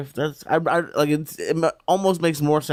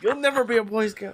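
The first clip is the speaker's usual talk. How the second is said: mock scolding; 8 semitones higher than usual. Mocking the lawyer's scolding.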